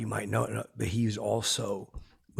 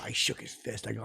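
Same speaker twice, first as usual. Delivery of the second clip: usual speaking voice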